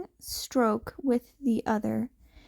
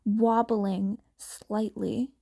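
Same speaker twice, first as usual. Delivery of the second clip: ordinary delivery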